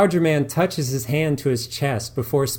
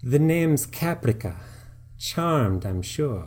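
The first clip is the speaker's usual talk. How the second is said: put-on lightly held hammy Eastern European accent; a hint of bad Eastern European accent; 3 semitones higher, slower than usual. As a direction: purr the name like a vamp. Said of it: "the worst Eastern European accent".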